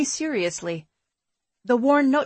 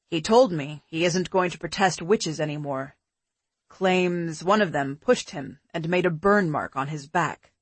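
put-on lower, relaxed boyfriend voice; man's voice; 5 semitones lower than usual. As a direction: drop lower for a calm man. Pete's dialogue, lower voice.

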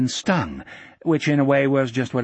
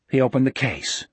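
plain reading voice

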